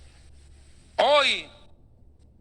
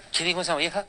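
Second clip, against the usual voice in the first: puzzled Satan; 4 semitones lower, 5 dB quieter, faster than usual. Pretend. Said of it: "reacción posible de Satanás".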